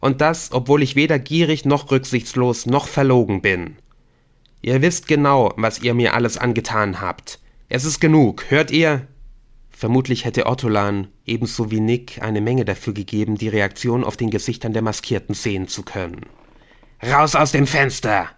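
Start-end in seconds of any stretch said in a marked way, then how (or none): 0.0-9.1 higher, louder defensive outburst; indignant, raised-voice objection; indignant protest. Acted. Captive protests against his accusers. 17.0-18.3 higher, louder order; forceful, raised-voice command; sharp command. Acted. Helen orders him out at gunpoint.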